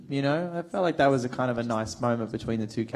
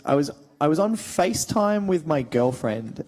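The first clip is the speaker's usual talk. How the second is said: ordinary talk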